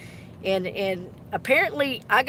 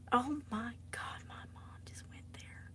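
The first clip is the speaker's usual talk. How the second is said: embarrassed whisper; 15 dB quieter than usual. Real, not acted. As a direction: whisper it, mortified. Mortified by mom's comment.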